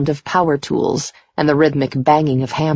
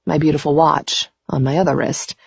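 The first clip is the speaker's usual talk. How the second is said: plain reading voice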